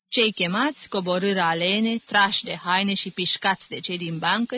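ordinary delivery, nothing marked

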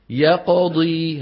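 very slow يقضي with a light bounce on the ق; minor qalqala demo. Shown as a demonstration. Teacher demonstrating minor qalqala to the class. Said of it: "نحو".

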